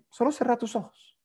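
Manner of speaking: soft, lifted hypnotist voice, stress on solo; calm hypnotist command; soft hypnotic instruction. Shown as a demonstration. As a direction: gently repeat the instruction. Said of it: "siempre es recomendable preguntar".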